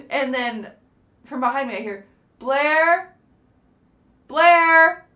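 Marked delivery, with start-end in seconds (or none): 2.4-5.0 calling out. Retold or imitated. Friend calling her name.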